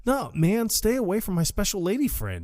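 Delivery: high-pitched, protesting comeback; mock indignant warning. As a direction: warn him off in a high, imitated protest. Acted warning to a rival.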